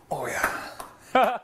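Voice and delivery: cheering a winning shot; excited cheer. Real, not acted. Celebrates a shot.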